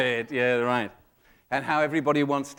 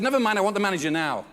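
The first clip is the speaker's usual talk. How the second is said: mock demanding; 5 semitones higher, 5 dB louder, faster than usual. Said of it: "I said".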